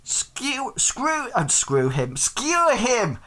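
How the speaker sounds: put-on high-pitched excited street boy; shouting boy. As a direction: pipe up high like a cheeky lad in the crowd. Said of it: "one of the boys sort of, like, says".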